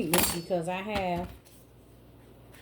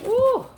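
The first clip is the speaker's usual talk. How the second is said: loud high ooh as the onion bites; loud stung ooh from onion fumes; 9 dB louder than usual. Real, not acted. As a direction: yelp it out. Onion stings her eyes.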